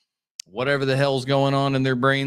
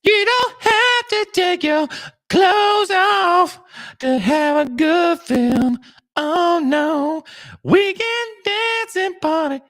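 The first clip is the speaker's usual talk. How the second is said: high mock voice; 13 semitones higher, 3 dB louder, much slower than usual. Mocking sing-song voice.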